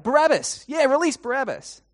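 loud, high shout from the crowd; high-pitched demanding yell; crying out. Acted. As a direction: shout it out as a rowdy onlooker. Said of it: "someone else cries out".

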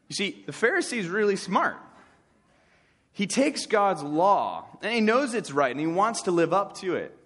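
ordinary talk

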